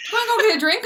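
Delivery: high-pitched retold invite; lilting, high imitation of an invitation; mock casual invitation. Retold invitation from dream Brad Pitt.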